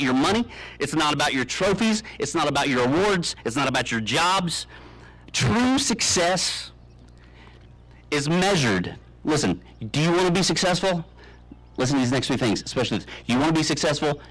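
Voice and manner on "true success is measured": drawn out very slowly, higher; milk each word for drama; slow dramatic. Acted. Speaker stretching out his big point, slow and dramatic. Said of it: "slow dramatic delivery".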